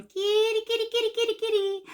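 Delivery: high sing-song kitty call; calling a cat. Retold or imitated. Calling a lost cat in the dark alley, high sing-song. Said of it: "when you start going".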